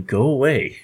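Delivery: normal talking voice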